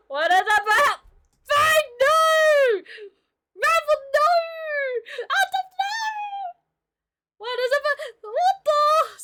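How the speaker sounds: high, loud mock-panicked squeal; squeaky shriek. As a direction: shriek at the drawing like a cartoon critter. Shrieking at the bird drawing.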